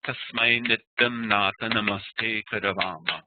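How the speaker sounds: Sanskrit verse recited with a marked rhythmic beat; rhythmic, emphasized poetic recitation; dancing metre. Shown as a demonstration. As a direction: let "Am sham shaste" dance in long and short syllables. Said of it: "You hear the kind of dance".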